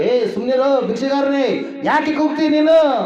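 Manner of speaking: mock rebuke from an impatient crowd; acted scolding. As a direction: scold like someone hushing a beggar. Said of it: "ಗದರಿಸ್ತಾ ಇದ್ದಾರೆ".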